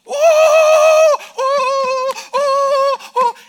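high loud woo cries; celebration whoops. Shown as a demonstration. Preacher showing a joyful crowd, loud high whoops. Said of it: "of great shouting".

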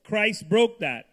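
loud, slow and emphatic; emphatic preaching. Real, not acted. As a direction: proclaim it with force. Interpreter echoes emphatic climax.

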